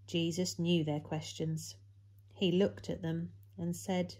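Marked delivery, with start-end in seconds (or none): none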